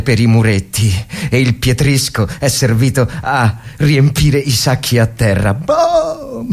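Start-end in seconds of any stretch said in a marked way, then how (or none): 5.5-6.5 high, sudden imitated boom; cartoonish explosion noise; mock explosion. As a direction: burst out a mock shell blast. Imitating shellfire.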